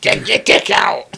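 loud, high, slow goblin bark; mock goblin yell; goblin shout. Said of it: "goblin voice continues".

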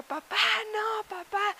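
slow, high-pitched mock plea; imitated pleading child. Sam at daycare begging dad to stay, pleading child. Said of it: "Sam estaba de que".

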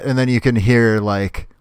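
ordinary talk

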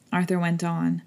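usual speaking voice

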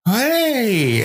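high eager mock greeting; a lightly voiced eager kid; mock eager hey. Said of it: "is like".

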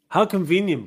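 high, loud sarcastic jab; loud sarcasm. Real, not acted. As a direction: throw the sarcasm right at him. Repeated sarcasm, louder.

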